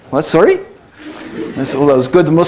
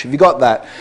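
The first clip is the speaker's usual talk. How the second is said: normal talking voice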